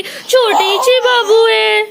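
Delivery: very high, slow, loud croon; high, slow and loud sing-song to a little one; loud baby talk. Acted. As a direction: call out over the crying in a stretched high voice.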